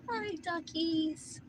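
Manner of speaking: high, soft, drawn-out coo; tender animal greeting. Real, not acted. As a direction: coo slowly to a little animal. Greets the ducks softly.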